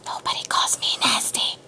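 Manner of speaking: hushed, breath-only aside; whispered indignation. Real, not acted. Storyteller takes offense at being called nasty, whispered. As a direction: whisper it to the listener.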